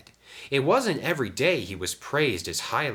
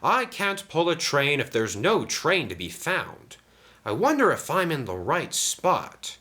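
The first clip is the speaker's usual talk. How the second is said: higher puzzled engine voice; talking to himself; 9 semitones higher than usual. A narrator's reading. Sam finds no train, wondering to himself. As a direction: think aloud, pitched up and unsure. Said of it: "he said to himself".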